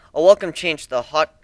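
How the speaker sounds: ordinary delivery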